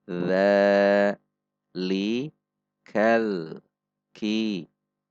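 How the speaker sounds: each word separate, slow, with long pauses; spelling out the verse. Shown as a demonstration. Teacher spelling out the verse word by word. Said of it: "Mari kita eja".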